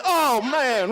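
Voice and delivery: pained cry. Retold or imitated. Patient reacting to the prostate exam with a loud pained cry. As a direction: let it burst out in pained shock.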